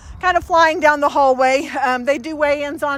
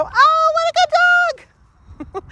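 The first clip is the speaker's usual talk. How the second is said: put-on much higher, slow, gushing baby-talk praise; gushing excitement; 14 semitones higher, much slower than usual. Visitor gushing over the family dog, high excited coo. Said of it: "They come in and go".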